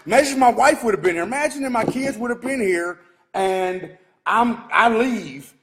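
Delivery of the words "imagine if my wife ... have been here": outraged rush. Real, not acted. Husband imagining his wife at the wrecked shop, fast and outraged. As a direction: rush it out in alarmed anger.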